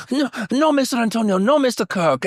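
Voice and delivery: high mock Spanish lover voice; Banderas-style voice. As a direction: ham it up high like the SNL sketch. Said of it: "that's what his face looked like to me".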